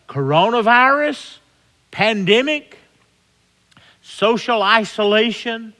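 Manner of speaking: high, loud preaching climax; full-force preaching. Acted. Preacher rallying his flock against the pandemic, high loud preaching. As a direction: drive it home to the congregation.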